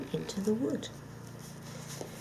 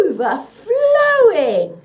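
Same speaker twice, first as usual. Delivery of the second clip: slow, much higher, laughing lie; sly lie; 10 semitones higher, 15 dB louder, much slower than usual. Acted. The dog lying to the fox, slow and gleeful. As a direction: lay the bait on thick. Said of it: "lied the dog".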